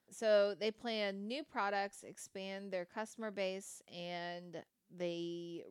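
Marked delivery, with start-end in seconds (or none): none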